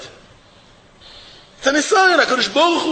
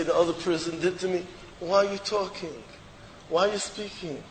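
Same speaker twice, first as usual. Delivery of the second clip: soft, low reproach; quiet rebuke; 4 semitones lower, 11 dB quieter than usual. Real, not acted. Rabbi chiding the complainer, soft low rebuke. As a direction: chide gently under breath.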